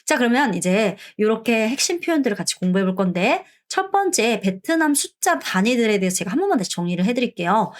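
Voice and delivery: ordinary delivery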